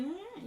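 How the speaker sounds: a short MM lifted high and quiet; needling inflection. Shown as a demonstration. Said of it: "that inflection in your words".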